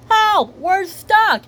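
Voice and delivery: high, loud, drawn-out plea; mock distress call. Mommy Pig and Miss Rabbit are trapped, high slow cry. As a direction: wail slowly for rescue.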